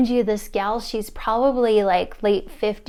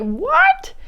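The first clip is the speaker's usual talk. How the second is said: very high, louder squealed question; excited scream; 5 dB louder than usual. Retold or imitated. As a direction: squeal it in astonishment. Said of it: "excited, like, kinda screams".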